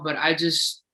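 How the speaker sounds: ordinary talk, nothing marked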